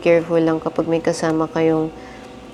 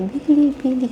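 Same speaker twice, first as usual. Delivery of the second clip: mild retold call; 8 semitones higher than usual. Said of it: "Sumisigaw yung babae".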